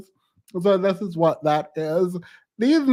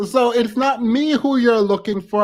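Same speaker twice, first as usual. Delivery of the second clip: ordinary delivery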